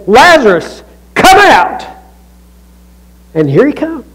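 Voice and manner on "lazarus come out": loud summons rising high on "come out"; acted shout of command; commanding call. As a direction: call someone forth from a tomb. Jesus calling into the tomb.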